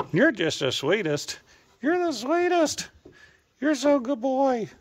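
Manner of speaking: gushing pet praise. Real, not acted. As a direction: gush over a beloved animal in falsetto.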